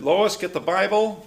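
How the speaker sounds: high, slow imitated order; loud command. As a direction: call out to the wife, drawn out and loud. Imitates husband ordering his wife.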